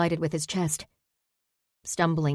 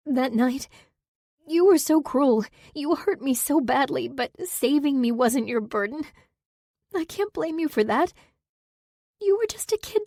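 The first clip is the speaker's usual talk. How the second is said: much higher, tearful and breaking; crying; 9 semitones higher than usual. Acted. Saber forgiving Zack while crying, voice breaking. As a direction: cry it out, voice cracking high.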